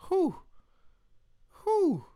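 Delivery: high falsetto "whew"; smitten, high-pitched whew; swooning whew. Real, not acted. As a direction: let out high whoops of being smitten. Swooning over Southern accents.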